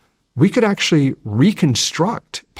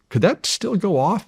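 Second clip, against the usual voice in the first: higher-pitched questioning quote; retold question; 9 semitones higher than usual. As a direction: voice a retold worried query, pitched up. Retells his question to an expert.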